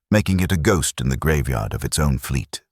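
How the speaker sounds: ordinary delivery, nothing marked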